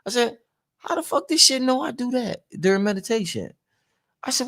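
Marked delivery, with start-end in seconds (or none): 0.8-3.5 retold private disbelief, pitched up; puzzled thinking to yourself, high voice; mock-baffled inner monologue; inner thought. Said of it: "I'm talking to myself in my mind".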